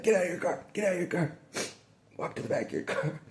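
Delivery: high, loud barked commands; cop shouting orders. Retold or imitated. Officer ordering the driver out and to the back, barking. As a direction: snap orders like a hostile officer. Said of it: "I didn't get that".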